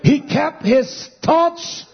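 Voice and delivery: high, emphatic pulpit delivery, rising as it goes; fervent, insistent proclamation; preaching building to climax. Acted. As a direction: hammer out short phrases with pauses.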